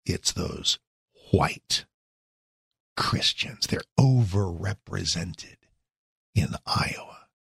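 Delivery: hushed, unvoiced mock snarl. Host mocking Joy Reid with a whispered snarl. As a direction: sneer it out in a whisper. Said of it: "she snarled".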